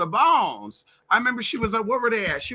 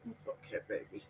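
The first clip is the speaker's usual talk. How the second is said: soft, hushed comfort to a dog; calm the frightened dog gently; soothing a pet; 4 semitones lower, 15 dB quieter than usual. Real, not acted.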